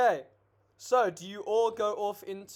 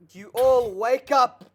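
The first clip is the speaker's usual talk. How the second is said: loud announcement; 4 semitones higher, 9 dB louder, slower than usual. Acted. GM announces loudly.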